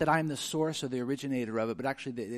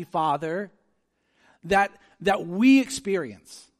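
high, loud, driving sermon voice; impassioned pulpit delivery voicing Jesus' prayer; full-force preaching; 8 semitones higher, 9 dB louder than usual. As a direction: preach it out with fervor and punch.

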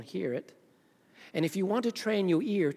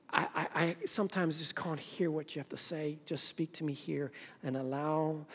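humble prayer; 5 dB quieter than usual. Acted. Worshipper asking God to open their heart, humble prayer. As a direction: confess it quietly to God, feeling for words. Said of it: "read with the word with the prayer".

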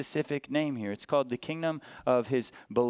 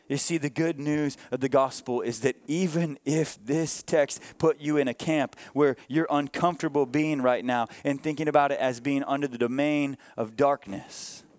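ordinary talk

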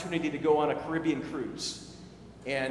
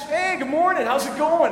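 higher and louder, a bright overfriendly greeting; cheery stranger; 9 semitones higher, 7 dB louder than usual. Retold or imitated. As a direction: play the chatty stranger who won't leave you alone.

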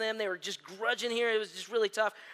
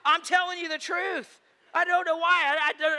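sheepish outburst; 8 semitones higher, 7 dB louder than usual. Real, not acted. Confessing fear home alone, laughing.

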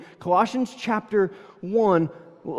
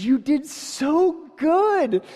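higher, very slow gushing praise; gushing race volunteer; 9 semitones higher, much slower than usual. Retold or imitated. Race lady gushes over Danielle at the finish, high and drawn out. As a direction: stretch it out like a beaming volunteer.

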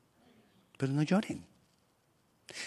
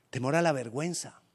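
normal talking voice

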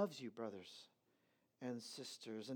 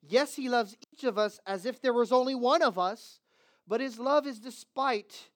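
raised, insistent sermon delivery; firm pulpit voice, somewhat raised; preaching; 6 semitones higher, 8 dB louder than usual. Acted. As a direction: press the point home to the church.

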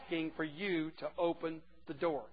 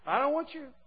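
dismissive message; 10 semitones higher, 7 dB louder than usual. Retold or imitated. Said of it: "you're getting a message".

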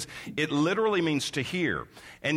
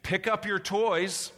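put-on higher, slower call to the kids; parent calling out; 6 semitones higher, slower than usual. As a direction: send it out across the house to children. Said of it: "your voice going out to your children".

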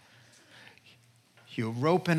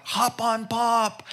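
slow, higher playful call-out; 6 semitones higher, slower than usual. Pretend. Dad on all fours calling his kids, playful slow call. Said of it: "you scream out".

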